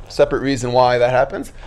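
ordinary talk

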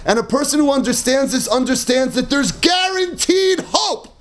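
impassioned preaching. Acted. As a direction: rouse them toward hope. Rousing preaching passage.

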